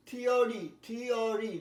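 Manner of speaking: normal talking voice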